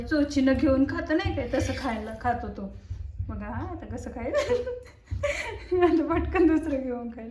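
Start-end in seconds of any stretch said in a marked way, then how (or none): 5.1-5.8 short high giggle; high laugh. Real, not acted. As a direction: burst into a high little laugh. Watching baby eat.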